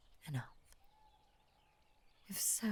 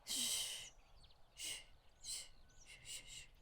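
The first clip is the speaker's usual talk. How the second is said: shushing; 5 semitones higher, 15 dB quieter than usual. Acted. Serpent hushes frightened listener.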